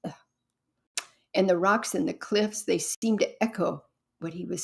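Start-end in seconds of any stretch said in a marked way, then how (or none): none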